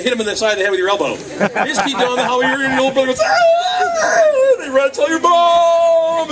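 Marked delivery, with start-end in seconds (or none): 3.2-4.6 very high, loud, drawn-out wail; mock child's wail. Little brother's acted wail. 4.6-6.3 high, loud, slow drawn-out cry; mock tattling wail. Telling on sibling, wailed.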